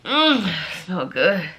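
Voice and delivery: put-on low, slow, savoring boy voice; mock a creep savoring a smell; drawn-out low 'mm', creepy; imitating creepy boy. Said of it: "And he said".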